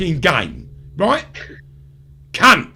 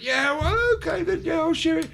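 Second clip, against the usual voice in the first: gullible agreement; 8 semitones higher, 5 dB quieter than usual. Retold or imitated. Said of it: "I went".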